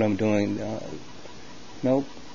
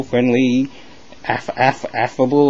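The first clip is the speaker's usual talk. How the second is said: ordinary talk, nothing marked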